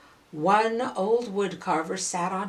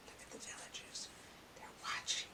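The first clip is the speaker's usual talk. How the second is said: whispered aside; hushed whisper; 24 dB quieter, faster than usual. Real, not acted. Storyteller whispers an aside to the child, hushed. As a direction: breathe it as a secret.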